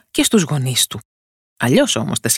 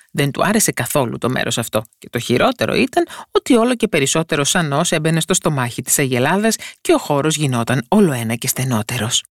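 usual speaking voice

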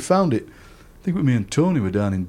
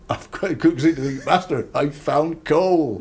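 mock cry of discovery, drawn out and raised; excited announcement; 4 semitones higher, slower than usual. Digger calls to the master about finding coal, excited announcement. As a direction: call out big news, stretching the words.

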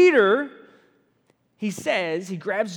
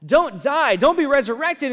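louder, higher urgent pleading; pleading protest; 6 semitones higher, 8 dB louder than usual. Retold or imitated. Peter urging Jesus not to die, loud. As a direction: beg him not to go. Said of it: "he's saying".

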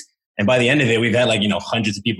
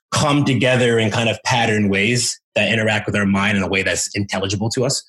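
normal talking voice

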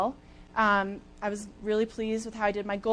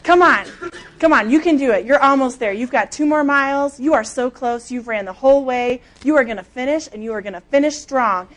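louder, pitched-up pep yell; 2 semitones higher, 7 dB louder than usual. Retold or imitated. Exhausted marathoner yelling herself to the finish, loud. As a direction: shout encouragement at yourself. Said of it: "I am yelling it out loud".

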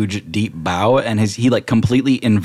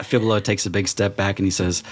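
normal talking voice

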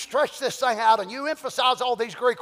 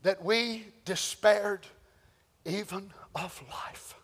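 slow, sinking to a breathy whisper; whispered, slow and broken; hushed despair; 6 semitones lower, 9 dB quieter, slower than usual. Acted. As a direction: let the words fade out of you. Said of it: "slowing to a near whisper".

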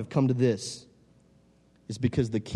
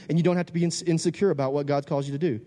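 ordinary talk

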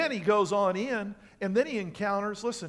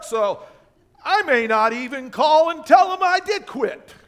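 mock-defiant, loud and high; loud hammed-up outburst of someone walking out; acted defiant worker; 5 semitones higher, 8 dB louder than usual. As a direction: play a worker who's done with the job. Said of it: "what many of you would do? You would go".

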